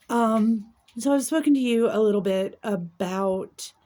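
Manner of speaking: ordinary talk, nothing marked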